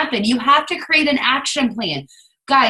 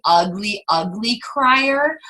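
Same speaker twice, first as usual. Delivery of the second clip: slow, dragged ugly crier; slow self-mocking drag; drawn out; much slower than usual. Real, not acted. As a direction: stretch each word wryly.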